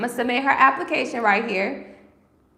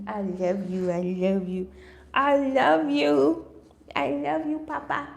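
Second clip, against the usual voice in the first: higher, cooing pet talk; 4 semitones higher than usual. Real, not acted. Owner asks her husky for a hug in cooing baby talk. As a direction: coo lovingly at the pup for a hug.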